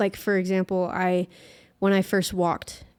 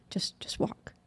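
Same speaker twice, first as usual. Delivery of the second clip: self-coaching whisper; 10 dB quieter, slower than usual. Retold or imitated.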